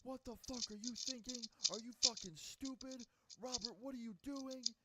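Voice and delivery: high-pitched, outraged mom tirade; mock screech of a furious mom; screaming angry mom. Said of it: "I could hear her screaming in his ear".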